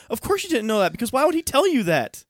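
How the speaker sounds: retold angry retort. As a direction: throw it back at him with scorn.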